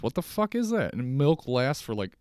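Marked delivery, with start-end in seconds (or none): 0.0-0.9 much higher, disbelieving; baffled outrage at the bread; incredulous. Real, not acted. Complaining to viewers about bread expiry, incredulous.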